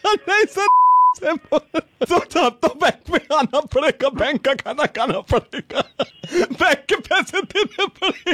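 slow words chopped up by giggles; helpless laughter. Real, not acted. Fake bank agent demanding money, laughing uncontrollably. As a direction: struggle the threat out through laughter.

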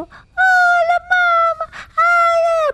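high, loud gushing squeal; overexcited pet greeting. Shown as a demonstration. Child demonstrates how not to greet a dog, gushing. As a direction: shriek a hello at the dog, way over the top. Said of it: "porque él no es así".

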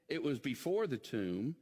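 ordinary talk, nothing marked

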